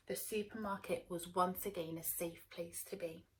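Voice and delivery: plain reading voice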